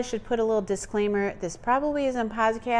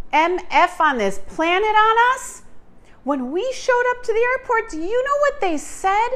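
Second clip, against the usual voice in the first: outraged; 9 semitones higher than usual. Real, not acted. Outraged high questions.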